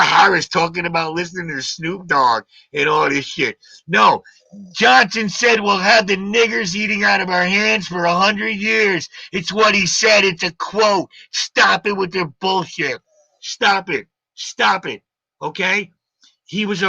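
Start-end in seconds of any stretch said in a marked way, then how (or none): 3.9-13.0 heated. Real, not acted. Angry rebuttal in argument.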